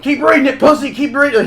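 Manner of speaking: high, loud bullying yell. Retold or imitated. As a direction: shout the order at your hostage. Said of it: "He's, like, yelling".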